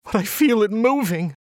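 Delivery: high, desperate cry on the edge of hysteria; hysterical cry. A narrator's reading. Alice begging her doctor, high hysterical cry. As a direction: voice her panic, pitched high and pleading. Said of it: "the woman cried".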